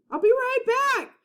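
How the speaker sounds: high call across the beach. A narrator's reading. Jack calling to Annie as he runs, high call. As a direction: call it over your shoulder. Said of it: "Jack called".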